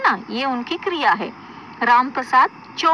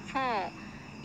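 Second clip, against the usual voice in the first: ordinary talk, nothing marked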